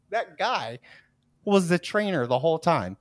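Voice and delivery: ordinary talk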